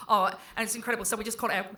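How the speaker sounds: put-on fast mumbled teen chatter, very quiet; imitating teenage daughter. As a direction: rattle it off quick and low like a mumbling twelve-year-old. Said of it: "She's like".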